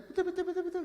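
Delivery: loud, very high squeaky chant; imitating a child. Six-year-old answering everything alike, loud squeaky mimicry. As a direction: parrot it loudly in a tiny voice. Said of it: "Anak enam tahun".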